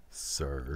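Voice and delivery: "sir" set apart, low and drawn out; odd emphasis on "sir". Acted. Host trying out Alan's oddly emphasized "sir", low and pointed. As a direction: lean oddly on "sir", dropping the pitch. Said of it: "He emphasizes the word sir oddly".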